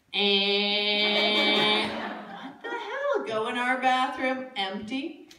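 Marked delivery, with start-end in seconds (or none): 0.1-1.9 long, level "ehhh" drone; imitated buzzing. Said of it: "I'm hearing this". 1.9-3.2 high, slow, drawn-out bewilderment; baffled reaction. Retold or imitated. Hunting the mystery noise.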